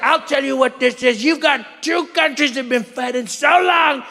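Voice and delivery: mock presidential outburst; exasperated cursing. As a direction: build to an outraged swear. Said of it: "He cursed live on T V".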